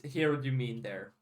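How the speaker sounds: ordinary talk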